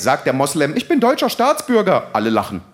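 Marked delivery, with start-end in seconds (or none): 0.8-2.1 high-pitched, affronted objection; indignant protest. Acted. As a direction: protest the accusation in mock outrage. Acted protest against the accusation. (hoch empörter Protest)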